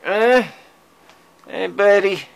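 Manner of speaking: high, loud sing-song to a cat; pet talk. Real, not acted. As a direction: coo up at the cat to get his attention. Talking to her cat.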